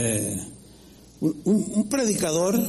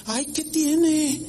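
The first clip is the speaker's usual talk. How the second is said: higher, with a slow drawn-out whine; whiny complaint; 7 semitones higher, slower than usual. Retold or imitated. Pastor voicing a churchgoer whining about the thermos rule.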